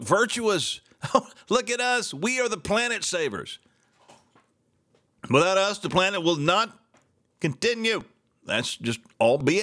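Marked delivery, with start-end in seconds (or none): 1.5-8.0 self-righteous mock voice, a bit higher; mocking impression of smug progressives. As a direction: sneer it like a smug do-gooder. Mocking leftists as planet savers.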